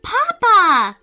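high, loud joyful scream; excited scream. A narrator's reading. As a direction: shout for Papa with joy. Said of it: "screamed Charlie".

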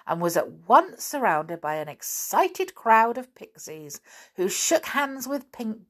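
plain reading voice

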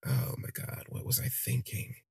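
low, breath-only murmur to oneself; hushed self-reproach. Acted. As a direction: whisper it in quiet disbelief at yourself. Narrator chides self inwardly.

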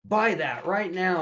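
high-pitched astonished question, then urging; acted thrill at a rare find; excited discovery. Dad spotting a rare book beside his son, excited urgency.

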